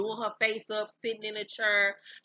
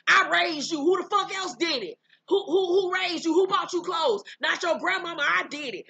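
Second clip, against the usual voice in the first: loud, high-pitched irate yelling; imitating a furious mother going off; 8 semitones higher, 7 dB louder than usual. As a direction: hammer out outraged questions at full voice. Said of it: "Tokyo is irate".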